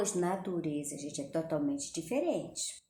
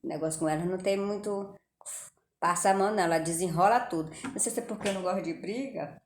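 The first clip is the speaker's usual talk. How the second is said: ordinary talk, nothing marked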